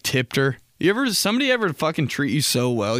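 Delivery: ordinary delivery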